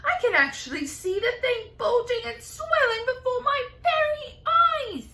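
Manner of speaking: shrill high yelling aunt. Acted. Aunt yelling at the swelling peach in shock. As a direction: yell it up high in alarm. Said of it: "Aunt Spiker yelled".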